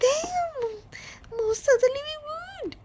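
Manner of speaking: very high indignant cry; offended, bristling; father crying out indignantly at Wonka. Acted. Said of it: "cried Mister Gloop indignantly".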